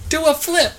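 high-pitched excited mock shout; imitated heckler yell. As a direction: holler it like a guy egging on a hero. Imitating movie guy calling out.

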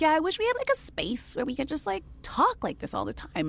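high, lightly voiced imitation of a woman; woman at book event. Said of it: "this woman said to me".

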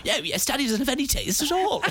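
high, laughing impression of his wife agreeing; joking about future kids, high laughing wife voice; mock Claire. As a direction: play the wife siding with the kid, giggling. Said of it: "And then I'll do Claire".

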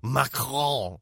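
mock French pronunciation, drawn out; newsreader's exaggerated French accent. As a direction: put on a French accent for MACRON. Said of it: "like the newsreader that we used to work with".

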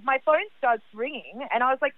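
ordinary talk, nothing marked

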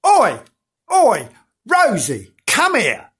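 sharp high calls to the dog; summoning shout; calling out. Acted. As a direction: call Rosie over in short bursts, falling at the end. Calling out to Rosie.

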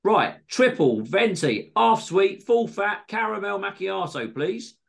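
high-pitched, demanding coffee order; fussy customer. A narrator's reading. Greg ordering coffee from Missus T, pompously demanding. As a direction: reel it off like an annoying regular.

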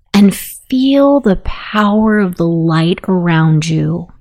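ordinary talk

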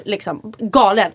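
ordinary talk, nothing marked

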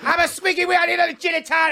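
high, loud mock drunk demanding a drink; drunk bar yell. Mocking friend's drunk bar demands, loud mock yell. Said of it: "You're like".

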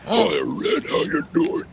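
mocking fake-deep greeting; hammed-up false bass, croaked out; forced deep voice. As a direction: imitate a rival straining to sound deep. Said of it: "everyone's trying to get a deeper voice than mine".

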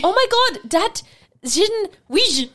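mock Flemish exclamation, high and loud; imitating Flemish. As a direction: shout it like a shocked mum abroad, in Flemish. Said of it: "I'm gonna try and speak in, um, Flemish".

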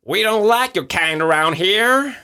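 a hostile Texan local; American accent. Acted. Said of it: "Det er min amerikansk".

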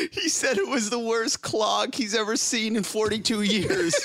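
high-pitched mock wail through laughter; laughing into crying. Wife on the phone relaying the plumber's verdict, mock laugh-cry. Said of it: "the laugh turns into a cry".

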